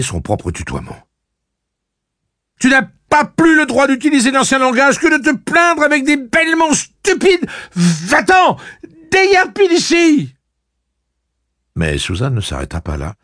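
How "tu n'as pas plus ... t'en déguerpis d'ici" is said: high, loud screaming rebuke; shrieked, furious order to leave; enraged dismissal. A narrator's reading. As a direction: drive her out with a furious yell. Enraged aunt orders niece out.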